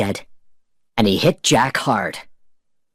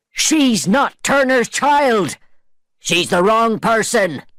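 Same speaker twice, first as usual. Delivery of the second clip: much higher, slow angry pirate cry; shouted; 10 semitones higher, slower than usual. A narrator's reading. Pirates shouting at Barbosa, high angry cry. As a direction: yell it like cheated pirates. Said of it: "The pirates shouted again".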